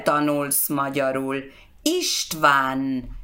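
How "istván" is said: normal talking voice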